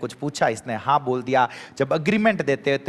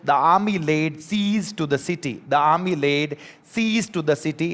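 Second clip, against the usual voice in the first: each word said very slowly; slow example sentence; much slower than usual. Shown as a demonstration. Teacher reading an example sentence slowly to the class. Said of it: "देखो".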